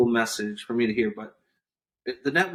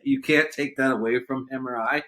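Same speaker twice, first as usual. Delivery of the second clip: ordinary talk, nothing marked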